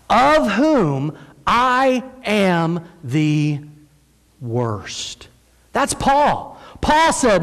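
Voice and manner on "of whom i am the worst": very slow, one word at a time, stress on "I"; weighty confession. Acted. Paul confessing he is the worst sinner, slow and weighty.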